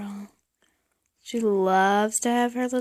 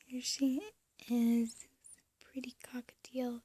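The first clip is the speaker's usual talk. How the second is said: soft whisper to a pet; 8 dB quieter than usual. Real, not acted. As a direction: coo it in a whisper. Cooing to the bird.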